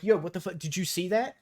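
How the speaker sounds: imitating a kid stirring up a fight; mock schoolyard instigator. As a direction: whip the crowd up, faux-outraged. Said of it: "You're like".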